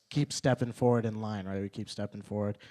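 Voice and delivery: normal talking voice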